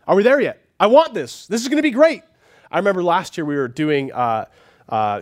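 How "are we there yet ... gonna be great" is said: put-on high, loud whiny kid begging; a kid nagging from the back seat, getting higher; child pestering parents, louder and higher each line. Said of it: "they're pestering their parents".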